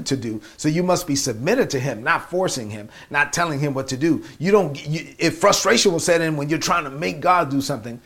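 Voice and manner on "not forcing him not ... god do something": normal talking voice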